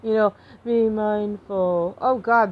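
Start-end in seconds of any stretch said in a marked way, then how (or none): 0.6-1.9 slow, drawn-out mindfulness voice; video yoga instructor. Retold or imitated. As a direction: imitate the guy on the yoga video, very slowly. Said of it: "the guy had a video on".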